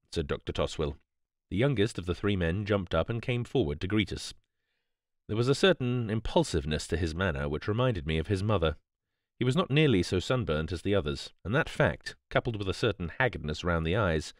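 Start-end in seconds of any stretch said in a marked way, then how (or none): none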